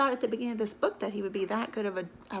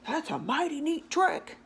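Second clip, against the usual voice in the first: higher, put-on voice of an impressed dog; 7 semitones higher, 4 dB louder than usual. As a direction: admire the trick in a dog's voice. Dog's thought voiced.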